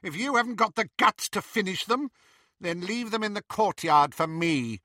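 high, contemptuous witch voice; sneering witch. Acted. Bellatrix mocking Draco, high contemptuous witch. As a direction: sneer at his weakness.

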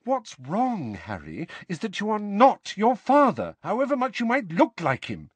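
high stern motherly voice; stern Mrs Weasley. A narrator's reading. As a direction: lay down the law like a mother.

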